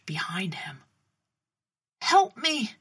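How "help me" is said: high, louder plea for rescue; acted desperate cry; crying out for help. A narrator's reading. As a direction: call out as if slipping under. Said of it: "the guard cried out".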